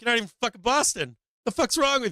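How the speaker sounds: high-pitched indignant challenge; mock outrage. Boston native challenging a kid over his tattoo, mock outraged and high. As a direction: call him out in mock indignation.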